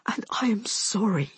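intimate whispered apology; hushed, sorrowful whisper. A narrator's reading. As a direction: breathe it into the ear. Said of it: "he whispered in my ear".

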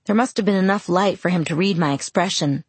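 ordinary delivery

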